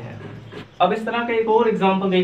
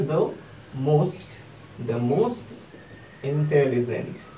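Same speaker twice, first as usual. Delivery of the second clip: the most intelligent drawn out very slowly and softer; slow model phrase; 3 semitones lower, 6 dB quieter, much slower than usual. Shown as a demonstration. Teacher modelling the superlative for students, very slow. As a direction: stretch every word for the learners. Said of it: "superlative form में लिखना हुआ तो क्या हो जाएगा".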